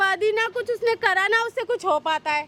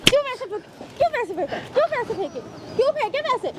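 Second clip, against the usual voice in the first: higher, insistent, hammering the same question; angry repeated demand; 5 semitones higher, faster than usual. Acted. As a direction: demand again and again why he threw it. Repeating demand after money thrown.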